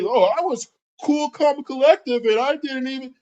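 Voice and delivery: mock his self-praise in a high voice; mocking high whine. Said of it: "You keep trying to say".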